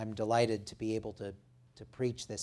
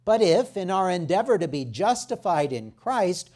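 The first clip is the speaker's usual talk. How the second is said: raised, emphatic public Bible reading; scripture reading; 5 semitones higher, 7 dB louder than usual. Real, not acted. Preacher reading Galatians to the congregation, emphatic scripture reading. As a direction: proclaim the passage, pitched up and driven home. Reads the scripture passage aloud.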